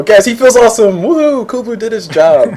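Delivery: loud, high woohoo for the viewers; hype cheer. Real, not acted. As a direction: cheer him on like a hype man. Host hyping guest to audience.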